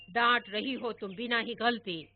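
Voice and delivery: loud playful teasing couplet; teasing rhyme. Retold or imitated. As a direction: rib the mother with a rhyme. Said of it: "और दादा चिढ़ाते".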